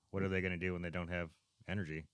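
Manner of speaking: ordinary talk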